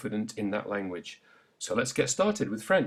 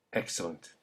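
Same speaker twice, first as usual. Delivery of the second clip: normal talking voice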